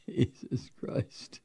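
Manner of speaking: slow unvoiced disbelief; whisper. Real, not acted. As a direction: breathe it out in despair. Host exasperated after laughing.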